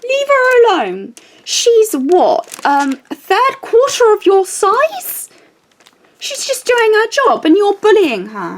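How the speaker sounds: put-on high, far-off old man's voice, pitched well up; high indignant elderly stranger; elderly man defending her. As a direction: stand up for her like a protective old gent. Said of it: "And he said".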